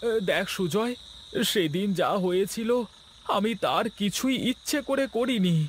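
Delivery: high, anxious pleading; pleading Gopal. Acted. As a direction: beg him to believe you, pitched high. (উঁচু গলায় ভয়ে মিনতি)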